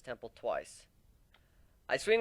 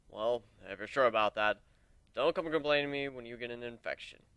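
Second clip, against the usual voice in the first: a hint of a lower, different voice for the swordsman; dry mock scolding, just slightly lower; lightly voiced Aoi; 3 semitones lower than usual. Swordsman Aoi warns the griffin about infection, lightly voiced.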